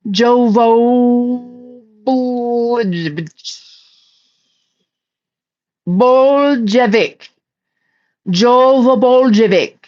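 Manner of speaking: Jovo Boljevic said very slowly, syllable by syllable; sounding out a name. Shown as a demonstration. Slowly sounding out the name Jovo Boljevic. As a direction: drag out Boljevic like reading it for the first time. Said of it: "Where are you from, Jovo Boljevic?".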